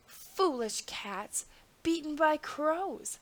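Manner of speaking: slow mocking bird voice; chirping bird. A narrator's reading. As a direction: chirp it out teasingly. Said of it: "a little bird chirping".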